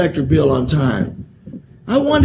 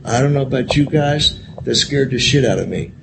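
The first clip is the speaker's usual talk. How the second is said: ordinary delivery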